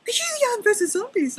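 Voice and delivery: high mock question from fans; squeaky imitation of a curious follower; imitating fans. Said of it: "people started messaging me and saying".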